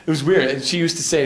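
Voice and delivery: ordinary talk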